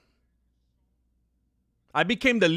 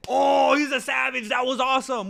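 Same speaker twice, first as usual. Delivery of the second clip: mock hyped shout; 9 semitones higher, 9 dB louder than usual. Fans in the comments cheering the savage finish, mock shout. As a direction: yell it like a thrilled fan. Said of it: "But then when he does it".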